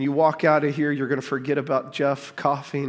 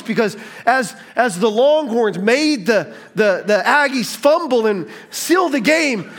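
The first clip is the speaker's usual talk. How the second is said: higher and louder, building excitement; fervent preaching; 9 semitones higher, 6 dB louder than usual. Acted. Pastor telling church about Longhorns win, excited preaching. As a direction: preach it with joyful fire.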